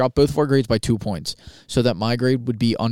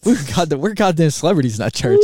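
mock proud boast; 6 semitones higher, 5 dB louder than usual. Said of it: "She should have been like".

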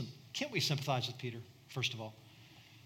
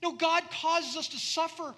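very high, loud shout; shouted rebuke; 13 semitones higher, 8 dB louder, slower than usual. Retold or imitated. Paul shouting down his companions, very high and loud. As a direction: yell it at the mistaken companions. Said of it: "Paul's like".

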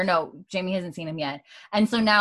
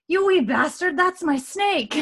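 indignant Jamie; 11 semitones higher, 4 dB louder, slower than usual. Acted. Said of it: "he sees Sonny and he says something".

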